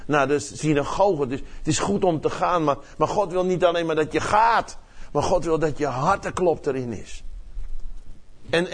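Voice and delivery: ordinary delivery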